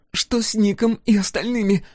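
a battered captive asking anyway; girl's voice. A narrator's reading. The captive girl asks Scar about Nick. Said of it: "произнесла девушка".

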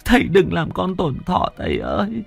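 sobbing daughter. Acted. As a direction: plead through light sobs. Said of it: "Huê khóc nấc lên".

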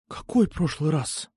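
slow, hushed alarmed question; frightened whisper. A narrator's reading. Alfa learns of a previous beating, frightened whisper. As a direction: ask slowly, mildly frightened, half-whispering. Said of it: "испуганно прошептала Альфа".

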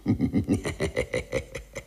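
soft sly snicker; quiet gleeful chuckle. Acted. Clerk gloating alone, soft sly laugh. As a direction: chuckle to himself over the prank. Gloating over his plan.

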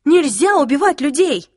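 loud, high-pitched appalled cry; outraged protest. Acted. Clary pleading against the killing, outraged cry. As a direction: raise the voice high and insist.

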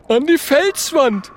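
high, loud little character voice; eager Oskar. Acted. Oskar backs up the friends' offer, eager. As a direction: chime in high and punchy.

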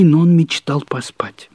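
usual speaking voice

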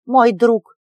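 loud proud announcement; proclaiming. A narrator's reading. Milok proudly announces his friend to the stranger, loud. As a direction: announce it to all. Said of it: "возвестил Милок".

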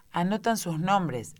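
usual speaking voice

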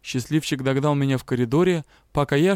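ordinary delivery

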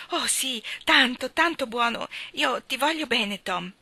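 overflowing with love for her brother; gushing little girl. A narrator's reading. Maggie gushing affection.

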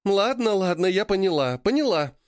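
hands up, backing off quickly; defensive backing down. A narrator's reading. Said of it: "в защитном жесте Мирантис".

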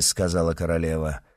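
ordinary delivery, nothing marked

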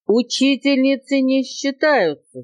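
high, drawn-out indignant exclamation; parrot's crying protest. A narrator's reading. Parrot dismissing elephant's teacher example, crying out. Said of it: "закричал попугай".

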